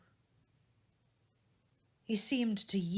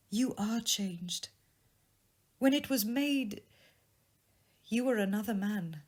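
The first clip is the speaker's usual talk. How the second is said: a lightly voiced young woman, a bit higher and sorrowful; lightly raised, grieving young woman; young woman's voice; 5 semitones higher, 8 dB louder than usual. A narrator's reading. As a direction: speak as the jilted sweetheart, hurt but composed. Belle voiced by narrator.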